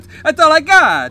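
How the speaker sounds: very high, loud protest; mock pleading yell. Driver opening his empty wallet, mock high-pitched yelp. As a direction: imitate a desperate man yelping.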